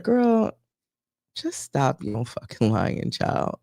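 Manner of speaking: hushed fed-up aside; exasperated scold. Retold or imitated. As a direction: mutter the truth she won't hear. What she wanted to say.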